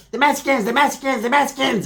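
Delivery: high, repetitive little-guy squeal; Dwarfwood. Retold or imitated. Showing how Dwarfwood looked with his ears, high mimicry. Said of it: "this is like Dwarfwood right there".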